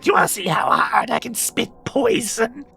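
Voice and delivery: high, eager little monster voice; a cheeky young creature showing off; lizard girl voice. Acted. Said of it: "she's like".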